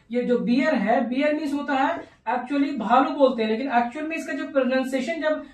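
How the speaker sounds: ordinary delivery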